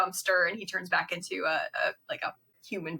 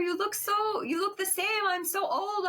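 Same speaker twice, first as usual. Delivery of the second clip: put-on old man voice; 13 semitones higher than usual. Old familiar voices Philip's line.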